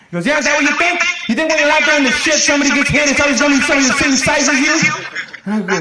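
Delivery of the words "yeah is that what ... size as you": high, heated sergeant rant; scolding sergeant. Acted. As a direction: berate a soldier with pointed questions. Sergeant scolding soldiers in a story.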